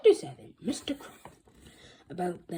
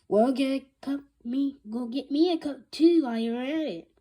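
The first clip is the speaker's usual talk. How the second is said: loud angry retort; growling back; 6 semitones higher, 9 dB louder than usual. Acted. Secretary answering the principal, loud growl back. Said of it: "Miss Ambrose growled back".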